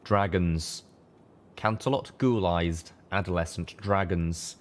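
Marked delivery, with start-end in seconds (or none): none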